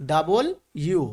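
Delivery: ডাবল ইউ in two separate words; slow syllable model of ডাবল ইউ; broken-down W pronunciation. Shown as a demonstration. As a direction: say ডাবল, pause, then ইউ. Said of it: "আসলে এর উচ্চারণ হবে".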